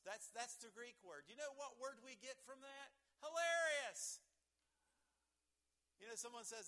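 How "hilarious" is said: "Hilarious" said much higher, louder and slowly drawn out; emphatic reveal. Shown as a demonstration. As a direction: land "Hilarious" as the big payoff, slow and loud. Reveals the word from Greek.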